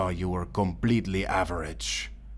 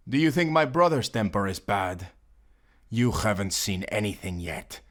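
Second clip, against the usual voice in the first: ordinary delivery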